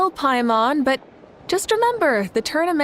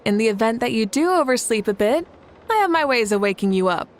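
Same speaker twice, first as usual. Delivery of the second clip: ordinary talk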